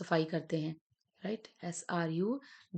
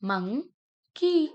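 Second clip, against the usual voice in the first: Monkey higher, louder and very slow; excited reveal; 6 semitones higher, 4 dB louder, much slower than usual. Shown as a demonstration. Teacher revealing the blended word, excited. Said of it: "क्या बन गया बच्चों".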